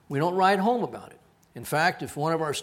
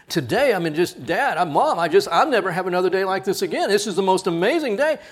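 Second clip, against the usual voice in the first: louder, rushing breathless gush; mock gushing student; 4 semitones higher, 8 dB louder than usual. Student gushing to parents over FaceTime, louder. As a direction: spill it out to Mom and Dad.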